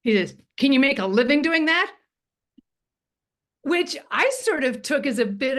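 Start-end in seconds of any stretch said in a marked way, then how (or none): 0.6-1.9 lightly imitated crusty Brit, louder and higher. Retelling a crusty Brit at dinner, lightly mimicked challenge. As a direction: put a touch of affronting doubt into a rising question. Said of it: "still as a crusty Brit".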